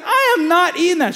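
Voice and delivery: put-on outraged teen, high and loud; indignant refusal. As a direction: push the plate away with your voice. Son refuses the brownie.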